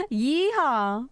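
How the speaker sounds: a laughing Texan yeehaw; cowboy yeehaw. Pretend. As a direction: whoop it like a rodeo cowboy. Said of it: "We get a yeehaw?".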